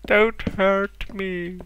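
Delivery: high, slow, drawn-out whimpering plea; mock cowering plea. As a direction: beg like a frightened coward hiding in a cave. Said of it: "hiding in the cave and cowering".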